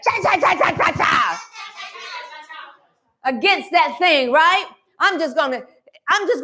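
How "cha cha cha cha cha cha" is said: rhythmic shouted cha chant. Shown as a demonstration. Preacher showing how to chant cha against trouble. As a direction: hammer out the chas. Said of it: "you need to say".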